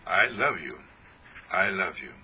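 loving farewell whisper; tender. Real, not acted. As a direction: let it melt away. Fading blessing.

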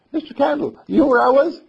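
put-on high, loud child voice; excited little boy. Boy wakes and calls Mister Candle, excited high voice. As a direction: burst out with the news in a boy's voice.

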